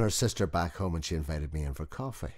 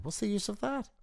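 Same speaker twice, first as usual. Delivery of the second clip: much higher, lightly voiced sceptical wife; higher wife's voice. A narrator's reading. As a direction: play the wife, pitched up and dismissive.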